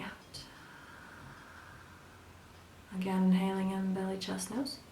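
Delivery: soft, slow breath-guide voice, exhales whispered; guided breathing. Acted. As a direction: count them through each breath gently. Leads a breathing exercise.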